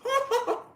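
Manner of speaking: high maniac giggle; shrill clown cackle; high clown laugh. Retold or imitated. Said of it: "Pennywise toys with her".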